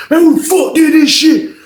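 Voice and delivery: high, outraged cry at the mess; shocked outburst. Retold or imitated. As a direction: blurt out in disbelief. Roommate reacts to bloody cell.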